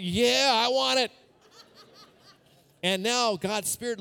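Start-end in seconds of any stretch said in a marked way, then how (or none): 0.0-1.1 eager laughing yes. Retold or imitated. Voicing own eager yes to sin.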